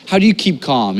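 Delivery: normal talking voice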